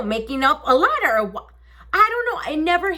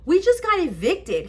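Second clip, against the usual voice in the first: high-pitched tearful report; lightly acted high whine; retold crying; 7 semitones higher than usual. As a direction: break the news to your husband in mock tears. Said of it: "I'm crying".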